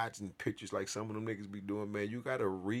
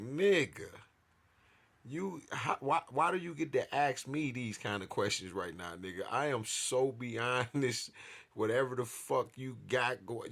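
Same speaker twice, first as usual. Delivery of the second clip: indignant; 4 semitones higher, 7 dB louder than usual. Retold or imitated. Retold indignant reply to officer.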